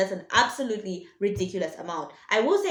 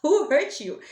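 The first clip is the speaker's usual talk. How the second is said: mocking, raised "Who hurt you?"; amused repeat; 6 semitones higher, slower than usual. Real, not acted.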